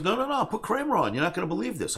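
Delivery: retold dad's excited phone voice; urgent insistence. Acts out dad urging him on phone.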